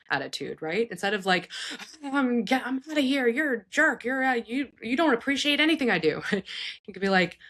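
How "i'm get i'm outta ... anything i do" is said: higher, fast huffy storming-off rant; mock angry lashing out. Parent storming out on an adult child, higher, fast mock anger. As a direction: act the parent storming out in a huff. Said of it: "lashing out in anger towards them".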